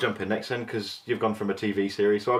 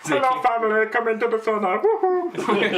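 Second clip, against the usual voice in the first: much higher, louder cheery mock German accent ending in a whoop; Frozen shopkeeper impression; 13 semitones higher, 5 dB louder than usual. Imitating the Frozen shopkeeper for friends, high cheery mock accent. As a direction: play the jolly sauna shopkeeper from Frozen. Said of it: "He sounds like the bloke out of Frozen".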